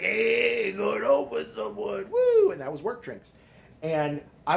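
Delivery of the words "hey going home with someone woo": put-on high, sing-song drunken tease that ends in a whoop; teasing whoop. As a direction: rib him like rowdy coworkers cheering at the bar. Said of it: "And they were like".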